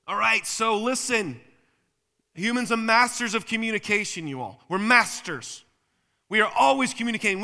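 high, loud sermon delivery; forceful preaching. Real, not acted. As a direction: preach it hard, punching the key words.

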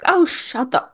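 high, playful mock scolding; imitated teasing. Star teasing the upset actress, playful mock scolding. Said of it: "like, teasing her".

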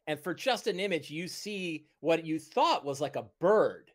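ordinary talk

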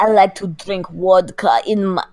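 hammed-up Russian accent. Host showing off an accent to co-host, Russian accent. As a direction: play a stock Russian in a put-on accent. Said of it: "With her Russian accent".

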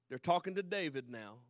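usual speaking voice

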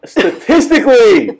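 STATISTICALLY pitched high, half laughing; high, emphatic STATISTICALLY through amusement; insistent. Real, not acted. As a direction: insist on the word STATISTICALLY, voice jumping up. Insists over the co-host's interruption.

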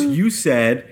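normal talking voice

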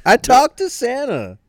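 high, loud dad brag; dad claiming he talked to Santa; mock dad boast. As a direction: crow it like a smug dad. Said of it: "That's classic dad move".

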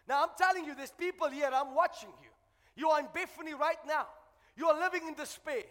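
loud, insistent address to the congregation; forceful preaching. Real, not acted. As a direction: press each phrase home with force.

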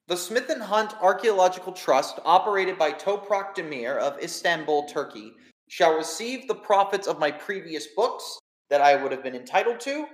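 formal, measured reading of a will; solemn will reading. Acted. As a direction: read it out like a lawyer, deliberate. Game master reads the will.

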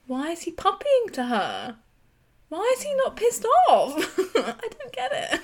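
high, loud outraged questions breaking into a laugh; indignant disbelief. Real, not acted. Indignant rant about Sean.

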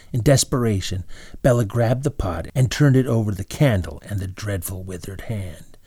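usual speaking voice

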